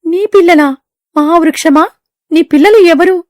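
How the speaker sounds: high, loud disbelieving question; shocked bird. Acted. Bird stunned to hear the tree has children. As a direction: cry out in disbelief.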